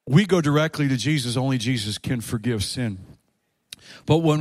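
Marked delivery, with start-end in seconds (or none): none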